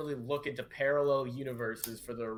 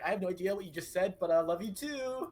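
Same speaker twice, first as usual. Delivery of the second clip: sweet reply; 6 semitones higher than usual. Acted. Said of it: "she just texts back".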